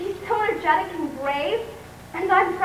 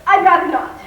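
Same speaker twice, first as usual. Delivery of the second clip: flustered refusal; 8 dB louder than usual. Acted.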